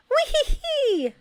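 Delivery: very high, slow giggle; high playful laugh in reply; laughing back. A narrator's reading. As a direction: giggle right back at him. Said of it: "she laughed back".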